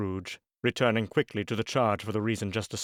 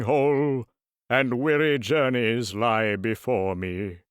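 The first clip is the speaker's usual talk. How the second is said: mournful ghost. Acted. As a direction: lament it like a chained spirit. Ghost's weary reply.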